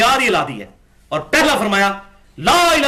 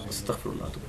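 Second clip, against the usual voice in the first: whispered; 16 dB quieter than usual. Real, not acted. Preacher recoiling at a quoted claim.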